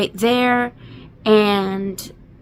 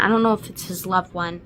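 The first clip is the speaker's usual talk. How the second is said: usual speaking voice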